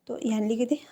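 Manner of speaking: ordinary delivery, nothing marked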